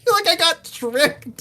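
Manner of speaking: high-pitched, through real laughter; laughing complaint. Real, not acted. Viewer admits to co-host the movie fooled them, laughing complaint. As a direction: squeeze it out while laughing.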